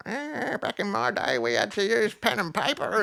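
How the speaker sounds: put-on high, creaky old-timer grumble; cranky old man. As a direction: whine it like a grumpy grandpa. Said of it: "you could sound like a cranky old man being like".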